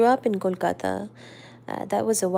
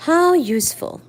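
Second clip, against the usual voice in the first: sharp, higher and cutting; incisive; 8 semitones higher, 7 dB louder, slower than usual. Acted. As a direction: cut it with dry sarcasm. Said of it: "Eleanor said incisively".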